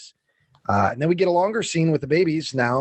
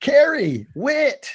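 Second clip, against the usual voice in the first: high-pitched calling out of names; imitation of someone hollering for the family; mock yelling; 10 semitones higher, much slower than usual. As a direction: call each name through the house, pitched well up. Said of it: "starts yelling".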